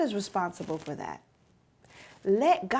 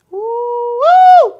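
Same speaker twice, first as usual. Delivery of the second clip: excited whoop; 14 semitones higher, 18 dB louder than usual. Real, not acted. Preacher whoops mid-sermon.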